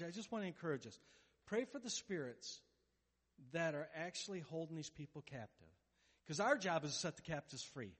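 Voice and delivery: ordinary delivery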